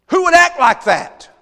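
shouted high, indignant demand; outraged shout. Retold or imitated. Preacher voicing David outraged at the rich man's deed. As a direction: bellow it like a king furious at injustice.

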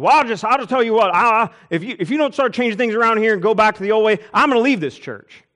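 shouted high and loud ultimatum; angry deacon threat. Retold or imitated. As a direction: deacon yelling he'll quit the church.

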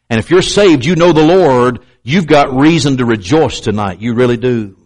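ordinary talk, nothing marked

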